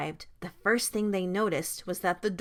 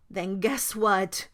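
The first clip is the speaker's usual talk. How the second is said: ordinary delivery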